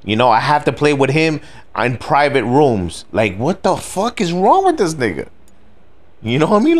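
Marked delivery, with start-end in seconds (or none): 3.4-6.8 sputtering at how dumb the guy is; exasperated disbelief. Real, not acted. Venting to viewers about a sore loser, exasperated high disbelief. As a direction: voice jumps high in outraged disbelief.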